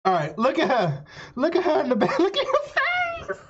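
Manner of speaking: loud, high, laughing look at her; squealing with laughter, pointing it out; cracking up at the stylist's face; laughing excitedly. Real, not acted.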